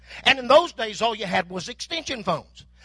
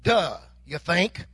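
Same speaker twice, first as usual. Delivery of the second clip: sarcastic duh; 5 semitones lower, slower than usual. Retold or imitated. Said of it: "my daughter used to put it like this".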